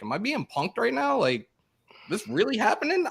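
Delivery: disbelief. Retold or imitated. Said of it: "I'm like".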